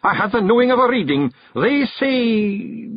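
proud Feegle chief, a light Scots voice; boastful little clan chief; Rob Anybody. Acted. Rob reads the chalk.

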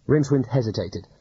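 ordinary delivery, nothing marked

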